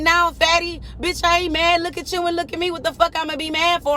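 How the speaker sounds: high indignant comeback; acted quarrel. Arguing back at the other mama, high indignant retelling. Retold insult match with the mother.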